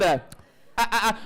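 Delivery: ordinary talk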